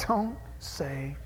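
slow, hushed whisper; hushing whisper. Acted. Adam hushes Eve while hiding from God, slow whisper. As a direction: hush your partner while hiding.

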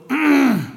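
attention-getting "ahem"; pointed throat clearing. Acted. God catches the believer crossing the line, pointed throat clearing. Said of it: "he'll go".

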